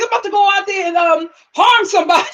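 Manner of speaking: high-pitched mock outrage; upset stepdad. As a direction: imitate a stepdad blowing up, amused. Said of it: "Like, he was so upset".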